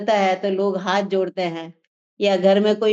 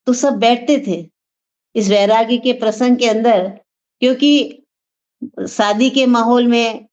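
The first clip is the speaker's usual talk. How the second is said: louder, driving sermon voice; full-force preaching; 6 dB louder than usual. Acted. Speaker urging the gathering about the soul, loud preaching. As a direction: preach it out to the hall.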